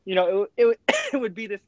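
coughing through the words; sputtering after a sip of water; choking on water. Real, not acted. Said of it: "sorry, choking on some water".

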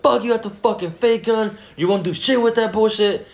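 high-pitched imitated defiance; heated. Friend defies the guy with a gun, heated imitation. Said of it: "she got more heated".

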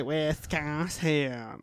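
exaggerated, drawn-out Wisconsin accent; mock Wisconsin accent. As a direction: imitate an overdone Wisconsin accent. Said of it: "when they try to put, like, the accent on it".

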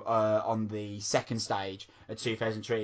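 ordinary delivery

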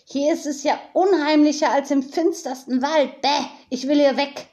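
high croaky raven voice, unsettled; croaking raven. Acted. Friedwart the raven croaking into the eerie silence. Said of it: "krächzte Friedwart".

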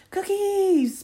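very high, drawn-out loud squeal; toddler scream. A narrator's reading. Little girl seeing the plate of cookies, piercing high scream. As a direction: scream it like an overjoyed tot. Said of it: "Penny screams".

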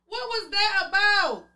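high, disbelieving what was that about; incredulous question. Real, not acted. Fan baffled by the timeout, high incredulous asking. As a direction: ask it like you cannot believe it.

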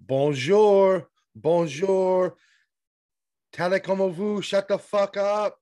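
high, loud mock French accent; a hammed-up French accent, slow on the last words. Telling a loud French woman at the bar to shut up, in mock French. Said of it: "And at some point I said".

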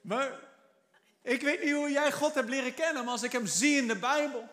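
ordinary delivery

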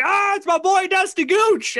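loud, high shout of a fan; hammed-up fan yelling his name; excited fan shout. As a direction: call out to him like an excited fan in the crowd. Fan calling out in crowd.

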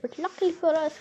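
usual speaking voice

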